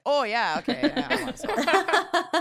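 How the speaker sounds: mock eager reply. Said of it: "And then I was like".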